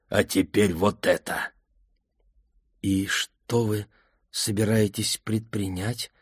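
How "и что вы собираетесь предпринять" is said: careful. A narrator's reading. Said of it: "осторожно спросила Ингрид".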